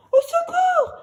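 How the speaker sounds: high, loud desperate cry; panicked call for help. A narrator's reading. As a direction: scream for help while drowning. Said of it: "crie la pauvre fillette".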